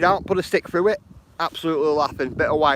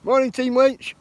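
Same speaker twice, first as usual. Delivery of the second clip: cheery greeting; 11 semitones higher, 4 dB louder than usual. Real, not acted. Greeting chat viewer on livestream.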